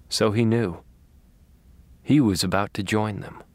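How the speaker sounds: usual speaking voice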